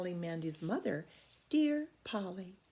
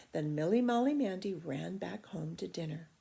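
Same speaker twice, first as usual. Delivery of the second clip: ordinary delivery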